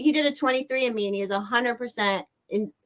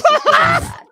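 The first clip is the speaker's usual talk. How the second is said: higher, louder joking claim; excited joking; 10 dB louder than usual. Real, not acted. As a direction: push it up with a grin.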